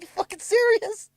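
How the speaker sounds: can't believe what he hears; disbelieving outburst. Retold or imitated. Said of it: "I'm like".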